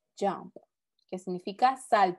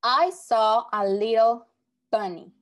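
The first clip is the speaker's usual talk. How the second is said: slow and louder, each word set apart; slow, louder example reading; 5 dB louder, slower than usual. Shown as a demonstration. Teacher reading an example sentence aloud, slow and projected. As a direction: read it out slowly and project to the class.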